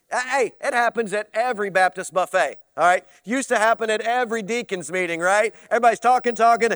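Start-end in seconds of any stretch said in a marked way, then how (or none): none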